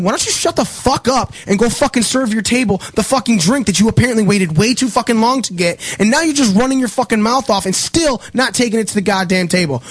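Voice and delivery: high, heated tirade; retold angry rant. Bartender telling the server off at the bar, heated and high.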